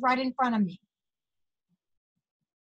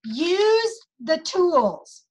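slow emphatic; 3 semitones higher, much slower than usual. Real, not acted. Coaching emphasis.

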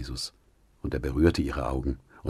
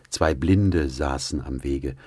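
usual speaking voice

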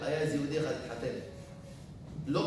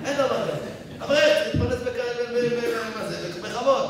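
higher and loud indignation; indignant protest; 7 semitones higher, 7 dB louder than usual. Real, not acted. Preacher protesting a scholar working unpaid, loud indignation. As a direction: protest it's unacceptable.

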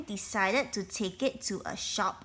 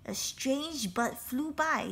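ordinary delivery

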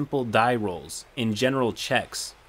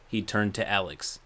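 normal talking voice

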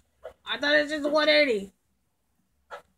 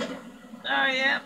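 ordinary delivery

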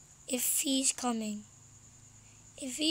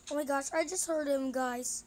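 ordinary talk

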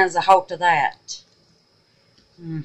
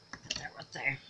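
whispered aside; 4 semitones lower, 18 dB quieter than usual. Real, not acted. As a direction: murmur it under your breath. Quiet aside while working.

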